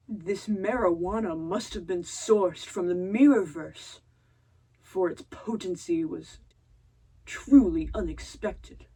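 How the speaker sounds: slow, theatrical proclamation; grandiose Gundam. Retold or imitated. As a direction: declaim it like a dark lord impressed. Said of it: "he's like".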